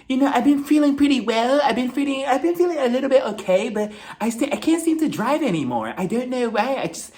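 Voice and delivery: high-pitched put-on rambling voice; mock a self-absorbed monologue, pitched well up; Dorit. Cast member going on tangents about how she's doing, mock rambling.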